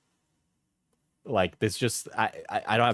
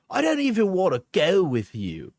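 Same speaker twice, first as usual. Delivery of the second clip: high-pitched mock Cockney whine; attempted Cockney; 6 semitones higher than usual. Streamer reading an angry partner's message, mock Cockney. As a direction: put on a high, exaggerated Cockney. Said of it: "It's Cockney, I think".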